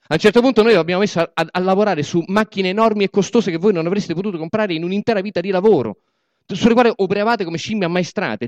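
high, loud speech to the crowd; booming speech from the podium; rally oration. Acted. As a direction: proclaim it like a political orator. Boss addresses comrades as rally.

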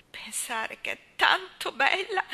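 high, hushed words broken by sobbing; through sobs. A narrator's reading. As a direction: Susan weeping over the baby, high and breathy with sobs. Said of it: "disse Susan tra i singhiozzi".

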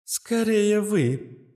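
very slow, dry AI reply. A narrator's reading. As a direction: drop it dryly like a machine. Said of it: "откликнулась Астра".

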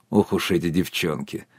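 soft, low, self-satisfied remark; contented. A narrator's reading. Sergei fondly teasing wife and guest, contented. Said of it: "сказал удовлетворённо".

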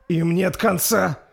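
slow, loud, high cry of horror; horrified. Acted. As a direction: cry out in dread. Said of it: "с ужасом произнёс воин".

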